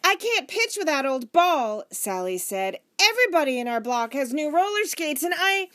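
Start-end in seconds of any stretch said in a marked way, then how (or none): 0.0-1.8 put-on high, loud boy complaining; children clamouring. A narrator's reading. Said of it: "the talk became louder and louder". 3.0-5.7 put-on high, loud child's protest; children shouting. A narrator's reading. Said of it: "until they were all shouting".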